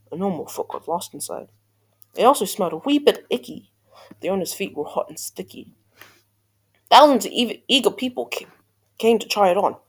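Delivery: ordinary delivery, nothing marked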